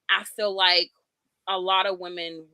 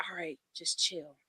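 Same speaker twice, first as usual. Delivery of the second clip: soft unvoiced whisper; whispered self-talk; 9 dB quieter than usual. Guest voices her own calming thought, whispered. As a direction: breathe it to yourself. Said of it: "when do you say".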